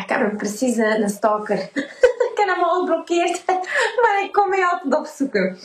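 giggling through the quote; laughing retelling. Retold or imitated. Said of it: "Die zegt".

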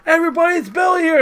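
high, loud mock announcement; calling out. Fan announcing Billy to everybody in line, calling out. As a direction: holler it out to the whole room.

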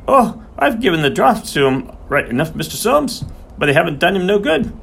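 high-pitched voice of a scared, defensive underling; scared big man. A narrator's reading. Scared horseman answers to his boss Soames, high and defensive. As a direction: protest in a high, anxious character voice. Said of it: "The big man looked scared".

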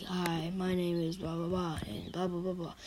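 low, soft, monotone video intro; expressionless imitation. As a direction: mimic an awkward beginner with no expression. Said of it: "then they go, they start talking with more expression".

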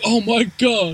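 high-pitched horrified exclamation, hammed-up; mock shock. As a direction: gasp it out in fake horror. Acts cops' shock at bodies.

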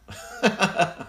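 real laugh. Real, not acted. Laughs at silly definition.

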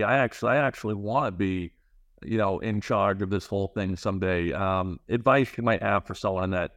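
normal talking voice

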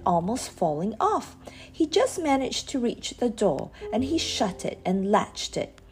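ordinary delivery, nothing marked

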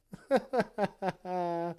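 high, stuttering cackle; mock laughing fit. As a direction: laugh as if mocking someone's search history. Mocking quote ends in laughter.

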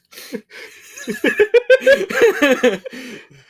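high, loud burst of laughter; big laugh. Real, not acted. Player cracks up at the Bleach joke, loud high laughing. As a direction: crack up in a long cackling laugh.

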